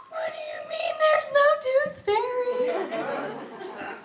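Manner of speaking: high, slow, hammed-up wail; devastated child. Daughter losing her childhood belief, mock devastated wail. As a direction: draw it out like a heartbroken little girl. Daughter's hopes destroyed, acted.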